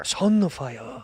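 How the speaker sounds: "Sunfire" with mock Japanese vowels; Japanese accent. Shown as a demonstration. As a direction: stretch "Sunfire" into a Japanese-accented "Sun-na-faya". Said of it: "they don't say it like that".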